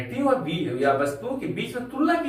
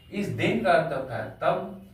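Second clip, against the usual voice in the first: normal talking voice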